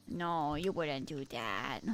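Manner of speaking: a teasing near-murmur; mock hushed plea. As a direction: trail the plea off into a hush. Meme line fading to nothing.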